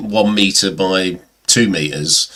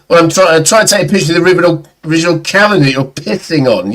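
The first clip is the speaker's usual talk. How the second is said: rapid, higher-pitched acted protest; flustered retold reply; 8 semitones higher, 4 dB louder, faster than usual. Photographer explaining to drunk man, in a flustered rush. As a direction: rattle it out, raised in pitch.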